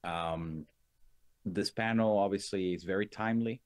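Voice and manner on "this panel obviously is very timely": ordinary delivery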